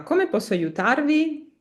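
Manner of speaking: "Come posso aiutarvi?" with a rising question tune. Shown as a demonstration. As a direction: lift "aiutarvi" at the end like a question. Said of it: "fai sentire che è una domanda".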